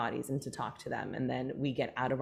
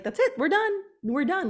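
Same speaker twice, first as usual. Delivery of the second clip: hammed-up alarm, much higher and louder; mock panic; 6 dB louder than usual. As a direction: play the body's inner alarm crying that it's over. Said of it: "And, like".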